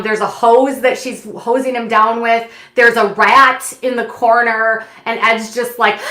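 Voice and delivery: usual speaking voice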